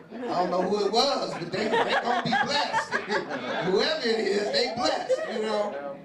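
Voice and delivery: high, loud laughing squeal; storyteller cracking up at the scene. Real, not acted. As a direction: crack up while telling it. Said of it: "laughing retelling".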